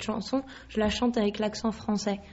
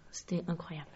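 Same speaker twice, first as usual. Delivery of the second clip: awed whisper; 3 semitones lower, 11 dB quieter, faster than usual. Real, not acted. Overwhelmed, nearly fainted.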